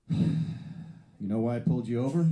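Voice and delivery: a hint of a ponderous Jersey cop; lightly voiced cop. Traffic cop questioning the driver, slow and lightly put on. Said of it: "he goes".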